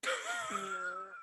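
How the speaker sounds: very high, raspy throaty cackle; raspy throat laugh. Retold or imitated. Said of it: "It's more raspy, like, from his throat".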